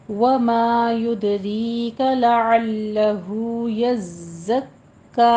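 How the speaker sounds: slow measured Quranic chant; tajweed recitation. Shown as a demonstration. Teacher models the verse for students, slow recitation. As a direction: draw each vowel out like a reciter. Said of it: "अलिफ मद्दा अदा कीजिए".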